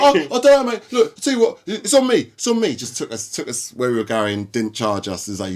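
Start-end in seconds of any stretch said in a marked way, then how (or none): 0.0-2.7 much higher placating driver voice; smooth it over like a guilty cabbie; sheepish cabbie. Retold or imitated. Cab driver backing down in story.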